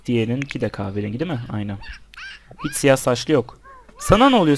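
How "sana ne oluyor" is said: scolding shout. Real, not acted. Scolding a grumpy Sims child.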